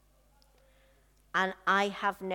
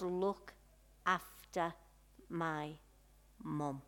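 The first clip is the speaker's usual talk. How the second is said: softer and lower, fading out; faint dying words; 3 semitones lower, 9 dB quieter, slower than usual. Acted. Dying Jesus asking for his mother's care, fading. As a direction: let each word fade like a last breath.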